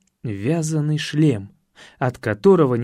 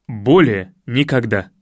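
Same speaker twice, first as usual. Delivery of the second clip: low, emphatic vow; с жаром; 5 semitones lower, 4 dB louder than usual. Acted. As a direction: swear it off with some heat. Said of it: "с жаром добавил я". (низко и с жаром)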